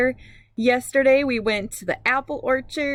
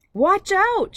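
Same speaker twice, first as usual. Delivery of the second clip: high, loud warning shout; yelled warning; 10 semitones higher, 4 dB louder than usual. Acted. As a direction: call it out sharply to the people ahead. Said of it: "she yells".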